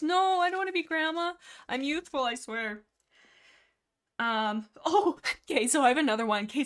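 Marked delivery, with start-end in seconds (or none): 0.0-2.8 mock offended. Real, not acted. Chat calls her Grandma [name].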